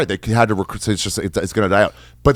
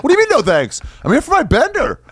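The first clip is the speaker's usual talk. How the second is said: high-pitched incredulous whine; mock indignant protest. Bender guy rebuffed at bar, mock indignant. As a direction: protest the rejection, comically high.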